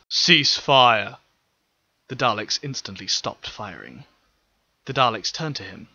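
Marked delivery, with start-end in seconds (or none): 0.1-1.2 louder firm order; stern command. Acted. Ryuk halting the Dalek fire, stern call. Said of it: "sternly called".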